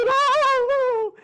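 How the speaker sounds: high, loud hammed-up cries of fright; mock frightened yelps. Preacher mimicking Dorothy's friends cowering before Oz, high frightened cries. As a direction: yelp like cartoon characters cowering. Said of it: "And they go".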